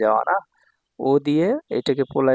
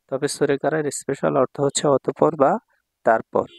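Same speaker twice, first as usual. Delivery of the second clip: ordinary delivery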